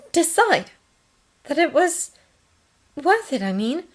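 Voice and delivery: high voice, halting between phrases; hesitant question. A narrator's reading. Nurse finishes her delicate question, hesitantly. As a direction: feel for the words, pausing. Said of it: "hesitated long enough".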